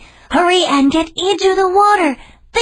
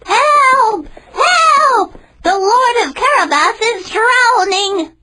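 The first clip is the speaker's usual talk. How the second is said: very high, loud alarmed shout; cat shouting for help; 5 dB louder, slower than usual. Acted. Cat shouts to the king's carriage, loud alarmed cry. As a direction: raise the alarm for all to hear. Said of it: "Puss in Boots shouted out".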